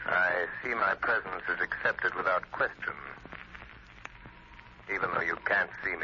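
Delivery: mocking phantom. The Shadow taunting Rankin, low and smug. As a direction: taunt them from nowhere.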